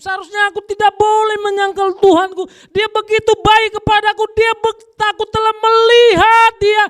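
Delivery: preacher voicing Peter's regret, loud and high; Peter's anguished lament. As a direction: cry out in remorse as Peter.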